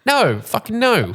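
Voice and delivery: high, drawn-out, indignant refusal; emphatic denial. Acted. Denying feelings after a day's doubt, emphatically. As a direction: stretch each no out, way up high.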